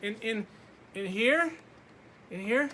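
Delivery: high, hesitant questions; a timid child guessing; nervous boy asking. Acted. Laszlo timidly asking the dark where to go.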